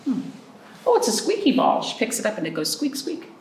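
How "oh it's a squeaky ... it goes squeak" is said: normal talking voice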